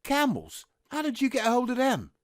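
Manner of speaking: high-pitched astonished exclamation; amazed. Acted. Soldier sees his comrade's prized cigarettes, amazed. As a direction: marvel at it, voice shooting up high.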